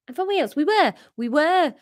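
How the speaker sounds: put-on high-pitched Welsh accent. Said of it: "he's Welsh".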